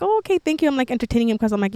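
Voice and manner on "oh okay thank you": bright high sing-song thank you; fake polite brush-off. To a pushy older stranger, mock polite high thanks. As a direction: sweetly humour him to get away.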